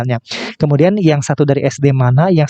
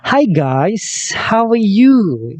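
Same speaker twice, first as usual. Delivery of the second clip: enthusiastic greeting; 6 semitones higher, much slower than usual. Shown as a demonstration. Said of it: "kita lihat ke video ketiga".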